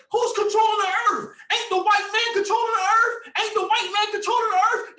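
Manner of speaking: high, loud mock outcry, imitating hecklers; mocking screaming followers. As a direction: squeal it like an overexcited heckler you're making fun of. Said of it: "some of you say".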